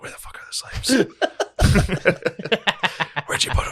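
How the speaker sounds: hushed, breathy question on a quiet tour; mock whisper. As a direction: whisper it like you're not allowed to talk.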